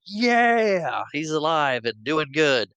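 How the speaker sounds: high, loud hammed-up cheer; mock cheer. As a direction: cheer like the heroes. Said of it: "you were like".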